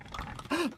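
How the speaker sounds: sharp, high gasp of surprise; a quick startled intake of breath; shocked gasp. Real, not acted. Shocked at guillotine joke.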